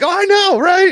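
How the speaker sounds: very high, loud squeal; gleeful agreement. Retold or imitated. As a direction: gush back in delight. Answers the confused kid gleefully.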